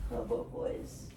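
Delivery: hushed, almost voiceless aside; soft words to the dog. Real, not acted. Speaking softly to her dog while upset. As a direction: drop to a near whisper, just for the dog.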